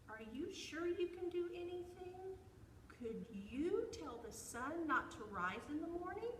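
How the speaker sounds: high, questioning squirrel voice; challenging squirrel. A narrator's reading. Squirrel dares bear to stop the sun, high-pitched and doubting. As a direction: pose the challenge high and sing-song.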